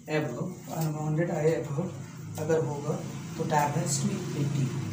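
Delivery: slow and deliberate, word by word; slow dictation. Shown as a demonstration. Teacher dictating a theorem to students, slow and deliberate. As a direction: spell it out slowly for notes.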